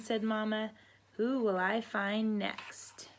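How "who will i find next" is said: slow whispered teasing. Acted. Mama Duck hunting for ducklings, slow whisper. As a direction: whisper it mysteriously.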